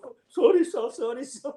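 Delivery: put-on young soldiers apologising while stifling laughs; mock sheepish apology. Young soldiers to the fallen officer, squeaky apology. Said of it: "they're all".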